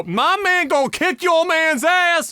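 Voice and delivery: high, slow mock girlfriend taunt; girlfriend picking a fight. Girlfriend taunting the other guy, high mock yell. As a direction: squeal it like the girlfriend starting trouble. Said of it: "The two girls go".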